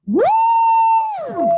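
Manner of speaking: very high, loud, sustained whoop; cheering woo. Real, not acted. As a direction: finish the chant with a piercing cheer. Cheer after the chant.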